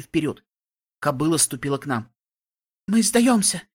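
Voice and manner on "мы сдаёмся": high, loud terrified cry; panicked shout. A narrator's reading. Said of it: "крикнула она".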